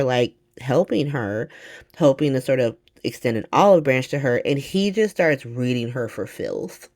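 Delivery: ordinary talk, nothing marked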